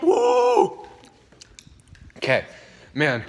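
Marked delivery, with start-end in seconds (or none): 0.0-0.8 very high strained whoop; pain whoop. Real, not acted. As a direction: cry out from the burn. Heat hitting.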